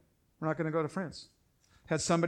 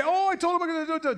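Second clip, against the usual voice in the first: mocking the wife; 11 semitones higher, 3 dB louder, faster than usual. Mocking a wife taking sides.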